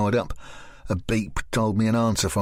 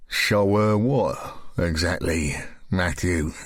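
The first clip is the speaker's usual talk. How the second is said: lower, slow menacing man; lower, slow sinister man; Graham's voice; 4 semitones lower, slower than usual. Acted.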